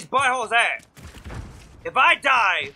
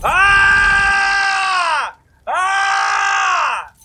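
two long, high, sustained yells; frustrated scream; 6 semitones higher, 4 dB louder than usual. Real, not acted. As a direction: scream out real gaming frustration. Frustrated screams while playing game.

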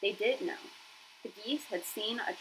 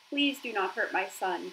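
ordinary delivery

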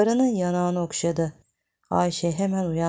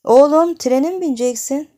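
loud call; 8 semitones higher, 10 dB louder than usual. Acted. Sudden loud call in story.

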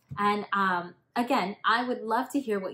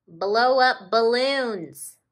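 loud, slow puffing voice; puffing raccoon; 4 semitones higher, 11 dB louder, slower than usual. Acted. Raccoons blowing up balloons, slow puffing voice. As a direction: puff the words out as if blowing. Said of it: "puffed the raccoons".

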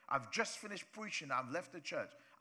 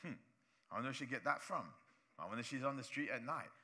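a hint of a low, suspicious gossip; lightly voiced sneering gossip; gossiping churchgoer; 6 semitones lower, 3 dB quieter, faster than usual. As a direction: mutter it like a spiteful neighbour. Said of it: "and you're like".

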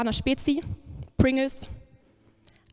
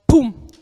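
a loud plosive "Pum!" like a hit landing; loud mock impact; 13 dB louder than usual. Acting out a lie hitting Christina, a loud "Pum". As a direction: punch the sound out like a shot.

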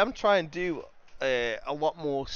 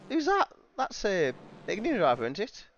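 excited; 8 semitones higher than usual. Real, not acted. Excited about on-screen racing.

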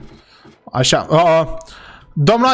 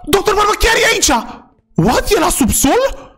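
much higher, amazed voice; high astonished exclaim; shocked; 13 semitones higher than usual. Acted. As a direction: sound stunned by the find. Finds doctor in the shop.

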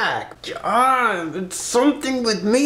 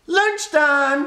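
excited announcement; 9 semitones higher than usual. Real, not acted. Announcing lunch.

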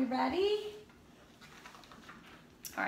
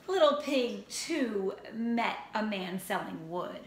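ordinary delivery, nothing marked

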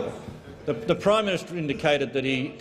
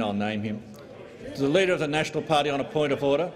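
ordinary delivery

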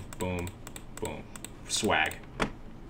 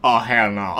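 ordinary talk, nothing marked

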